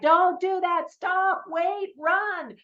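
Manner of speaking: high-pitched, half-playful urgent shouts; urgent pleading at screen. Acted. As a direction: call out warnings to a movie character. Interacting with the movie screen.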